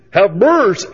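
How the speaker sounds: loud, high practice cry; rehearsed plea. Acted. Woman rehearsing in front of her mirror, overdone plea. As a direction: try the plea with a big, exaggerated inflection. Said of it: "gone through all sorts of inflections".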